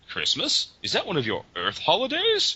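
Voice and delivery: lightly hammed-up alien bafflement, rising; mock puzzled question. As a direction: imitate a baffled cartoon character. Voicing puzzled cartoon character's question.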